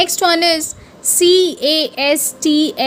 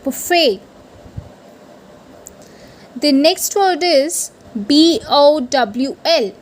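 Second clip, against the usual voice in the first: normal talking voice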